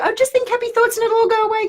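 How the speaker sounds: very high, airy sing-song cheeriness; superficial mock cheer. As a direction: parody shallow positivity. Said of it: "do the kind of superficial".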